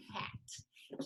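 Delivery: "Cat" whispered with one clap; whispered syllable. Shown as a demonstration. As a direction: breathe "Cat" on a single soft clap. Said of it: "Let's clap it out".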